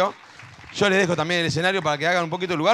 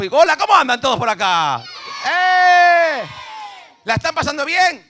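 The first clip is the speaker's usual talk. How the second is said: high, loud crowd hype; saludo a gritos; 10 semitones higher, 7 dB louder than usual. Real, not acted. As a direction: holler out to the whole audience. Saluda a gritos a todo el público. (arengando al público a los gritos)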